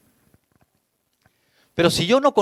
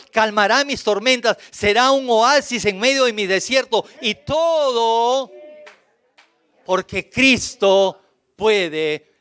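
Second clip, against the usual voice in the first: higher, building fervent promise; preaching; 6 semitones higher than usual. Acted. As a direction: climb in fervor with each line. Preaching at full force. (predicación que va en aumento)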